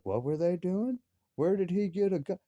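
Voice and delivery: mother's question. Retold or imitated. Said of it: "And she's like".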